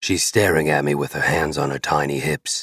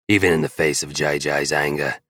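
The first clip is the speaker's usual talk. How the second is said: plain reading voice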